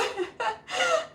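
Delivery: sudden squeaky giggle; high laugh. Real, not acted. Host laughing at a viewer who is also in bed. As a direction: burst into a high little laugh.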